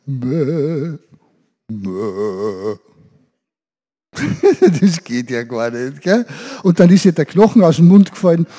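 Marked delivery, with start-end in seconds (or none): 0.1-2.8 low bleat Mäh; deeper goat bleat. Shown as a demonstration. Trying a deeper Mäh for the children. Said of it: "ein bisschen tiefer". 4.1-4.8 high burst of laughter; real laugh. Real, not acted. Laughing at his own goat bleat in front of the kids.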